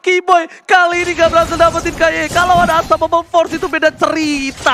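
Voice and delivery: loud, high play-by-play shout; hyped caster shout. Real, not acted. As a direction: yell the team fight live. Caster hypes a kill. (teriakan caster yang heboh)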